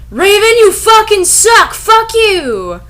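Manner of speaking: high, loud shrieking insult; hammed-up angry yell. A goth girl screaming at her rival, hammed-up furious yell. Characters fighting in the story.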